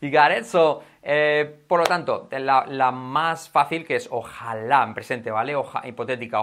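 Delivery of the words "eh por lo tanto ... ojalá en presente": usual speaking voice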